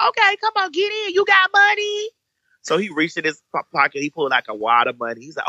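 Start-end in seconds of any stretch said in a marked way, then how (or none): 0.1-2.1 high flirty voice. Retold or imitated. Flirting falsetto to the guy.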